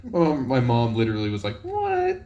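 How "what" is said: high shocked "What?" imitating mom; mock mom's stunned squeak.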